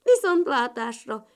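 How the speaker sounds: loud frog-like farewell; touched croak. A narrator's reading. Frog child parting from the ladybirds, touched croak. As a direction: croak goodbye as the moved little frog. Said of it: "kurutyolta a zöld béka gyerek meghatottan".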